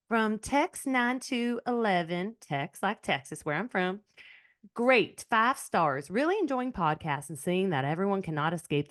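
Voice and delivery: exaggerated, unconvincing Southern twang; bad Southern accent. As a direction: read it out in a badly faked Southern accent. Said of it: "That was a really bad Southern accent".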